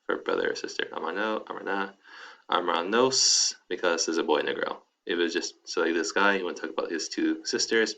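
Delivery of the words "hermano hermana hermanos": "hermanos" drawn out slowly with a rise; slow model. Shown as a demonstration. Teacher modeling the plural for students, slowly. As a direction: stretch "hermanos" out and lift the end. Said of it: "teacher models slowly for students".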